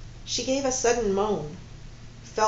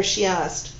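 usual speaking voice

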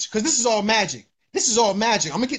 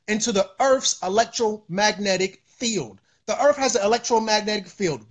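usual speaking voice

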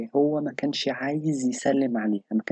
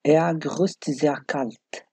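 slow demo; much slower than usual. Shown as a demonstration. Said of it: "هو بيسلم كده ببرود".

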